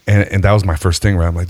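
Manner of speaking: normal talking voice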